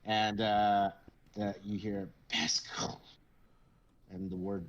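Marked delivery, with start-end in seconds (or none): 2.3-3.0 eerie whispered name. Acted. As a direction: breathe the name like a ghost.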